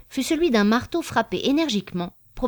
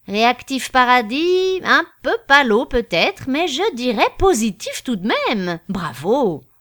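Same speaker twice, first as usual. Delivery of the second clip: pompous judge; 5 semitones higher, 6 dB louder than usual. Acted. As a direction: savour each word like a self-important magistrate. Judge voiced by narrator.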